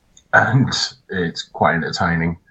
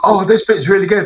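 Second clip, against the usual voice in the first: put-on high, eager little boy voice, a bit louder; excited kid; 12 semitones higher, 4 dB louder than usual. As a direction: gush like a thrilled eight-year-old. Nephew voiced excited in cinema.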